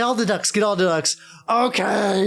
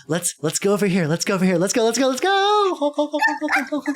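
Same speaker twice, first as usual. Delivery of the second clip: rising higher toward an excited honk; eager clown; 10 semitones higher than usual. Acted. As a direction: clown hurrying a player along, getting more excited. Clown excitedly leading player away.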